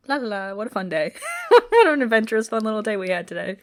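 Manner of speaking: fast, laughing singsong of smug cheer; mock carefree. Cohost imitating Damon sipping his drink, mock carefree. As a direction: breeze through it like nothing's wrong.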